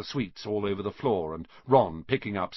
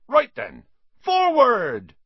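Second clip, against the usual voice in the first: loud command building to a high yell; shouting; 13 semitones higher, 9 dB louder, slower than usual. A narrator's reading. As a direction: launch the fleet with a rising bellow. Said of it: "shouted Hagrid".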